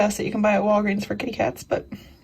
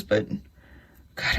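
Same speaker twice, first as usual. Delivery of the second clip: ordinary talk, nothing marked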